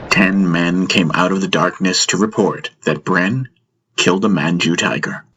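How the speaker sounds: ordinary delivery, nothing marked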